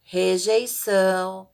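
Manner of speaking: slow word with the nose held closed; blocked-nose, buzzing nasal ending; nose pinched shut. Shown as a demonstration. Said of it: "tampou o nariz?".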